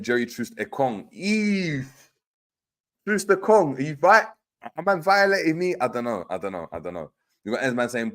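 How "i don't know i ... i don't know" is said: fading out. Real, not acted. Repeating, fading away.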